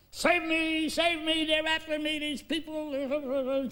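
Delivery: high, pleading cry for help; frightened plea. Retold or imitated. Hunted man begging the young woman to hide him, high frantic plea. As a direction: beg her to save you in a high voice. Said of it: "she says in there".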